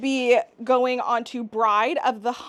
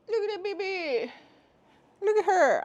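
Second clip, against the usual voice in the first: higher cooing voice for a pet; 7 semitones higher than usual. Real, not acted. Cooing at dog between legs.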